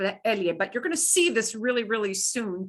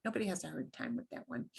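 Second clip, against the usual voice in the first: usual speaking voice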